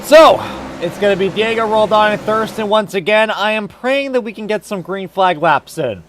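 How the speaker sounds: loud race-commentator call; live play-by-play. Real, not acted. Announcer calling the field back to green, loud play-by-play. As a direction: project it, building into the restart.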